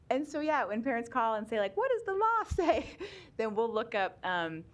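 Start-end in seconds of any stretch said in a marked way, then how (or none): 1.8-2.9 high-pitched, laughing parent impression; imitated caller question. Staffer imitates parents calling about the law, high and laughing.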